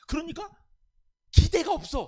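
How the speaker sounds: exasperated outburst. Acted. Doubter's voice peaks.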